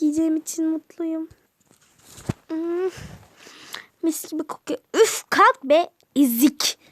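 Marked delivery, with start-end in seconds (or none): 4.9-6.8 louder, scornful get-up command; loud mean sister insult; rude bossy order. Acted. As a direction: shove her off with words. Sister bullies Cemile again.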